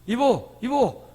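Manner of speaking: a hint of a husband calling his wife; lightly voiced call across the house; husband calling out to his wife at home, mildly sing-song. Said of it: "이렇게 불러요".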